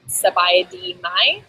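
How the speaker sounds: "Sabai di mai" said slowly, rising on "mai"; slow demo phrase. Shown as a demonstration. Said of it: "you could say".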